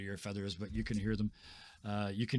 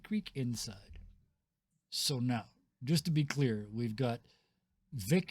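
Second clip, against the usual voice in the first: ordinary delivery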